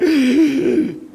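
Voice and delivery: sharp, high, strained gasp; mock out-of-breath gasp; gasping for air. Shown as a demonstration. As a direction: suck in thin mountain air in one gasp. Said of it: "You're kinda like".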